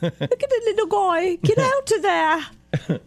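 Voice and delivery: high-pitched, badly faked Scottish; attempted Scottish accent. Host imitates Scots coaxing a squirrel, clumsy Scottish accent. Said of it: "I can't do it".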